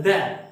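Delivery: "the" said short and open, without the long ee; short, unlengthened vowel in "the"; weak form of the. Shown as a demonstration. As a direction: say "the" as a short thuh. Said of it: "have to pronounce this word as".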